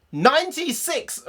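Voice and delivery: high, slow laughing outburst; laughing disbelief. Real, not acted. Vlogger laughing at how many quiz questions are left.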